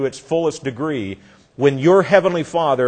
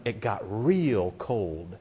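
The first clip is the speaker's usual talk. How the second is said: ominous slow line; 7 semitones lower, 12 dB quieter, much slower than usual. Acted. Preacher dramatizes the chill.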